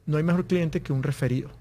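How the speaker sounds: usual speaking voice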